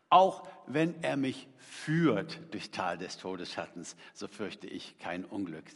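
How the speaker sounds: slow, lightly solemn recitation of the rewritten psalm line; reading the edit. Pretend. Preacher reading his fix to David, solemn. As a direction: read the improved verse slowly and solemnly. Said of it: "ihm mal was ins Heft geschrieben".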